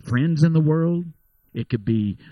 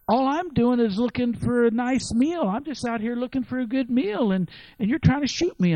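raised bear voice, hurt and pleading; a hint of an offended bear; 8 semitones higher than usual. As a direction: bear protesting to the hunter, lightly voiced. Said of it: "And the bear says".

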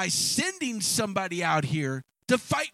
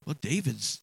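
ordinary delivery

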